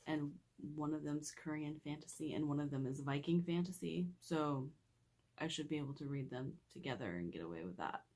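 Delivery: just-woke-up gravelly voice; rough sleepy gravel in the voice. Real, not acted. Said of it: "like, super gravelly".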